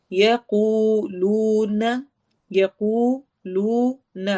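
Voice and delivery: یقولون slow, both waws stretched two counts; waw madd. Shown as a demonstration. Teacher reciting for the class, stretched. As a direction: draw out each و in یقولون. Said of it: "دونوں جگہ پہ دو حرکہ لمبا کرنا ہے".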